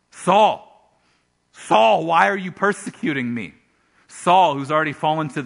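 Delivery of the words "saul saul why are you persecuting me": called out high and loud, commanding; voice from heaven. Acted. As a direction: cry his name out like a voice from the sky. Voice of Jesus from heaven.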